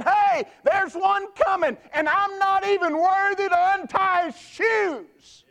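high, loud, slow announcing; humble but booming herald; John the Baptist proclaiming. Acted. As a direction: call out that someone greater is coming. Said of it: "John the Baptist the whole time is saying".

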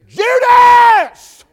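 ordinary talk

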